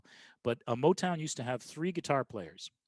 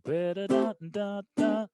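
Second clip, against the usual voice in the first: scatted guitar riff, pitched high; high vocalized Motown lick; guitar part imitation; 7 semitones higher, slower than usual. Shown as a demonstration. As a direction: sing out the riff in slow syllables. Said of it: "exclusively to play that".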